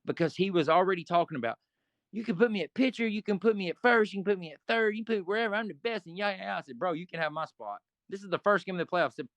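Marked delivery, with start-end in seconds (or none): 2.1-6.1 higher, bragging mimic of Bull; lightly mock the loudmouth's boasting; mocking bragger. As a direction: teammate bragging he can play anywhere, mocked higher.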